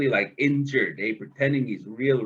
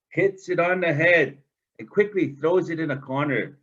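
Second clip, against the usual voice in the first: plain reading voice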